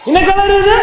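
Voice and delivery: high-pitched, rising call for an answer; உரக்கக் கேட்பது. Real, not acted. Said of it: "கேட்கலடா எனக்கு". (உயர்ந்த குரலில் பதில் கேட்டு மீண்டும் கேள்வி)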